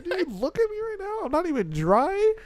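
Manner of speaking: retold squeaky indignation; incredulous protest. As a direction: voice shooting up high. Acted protest to grandmother.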